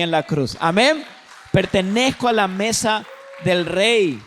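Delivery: loud, ringing preacher's declaration; full-force preaching. Acted. Pastor rallying the congregation against sin, loud preaching. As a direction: proclaim it to the whole room.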